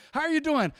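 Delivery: high-pitched put-on greeting; faked cheer. Believer faking it by faith, high cheery greeting. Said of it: "you always gotta fake it".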